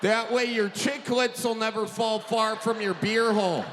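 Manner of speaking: ordinary talk, nothing marked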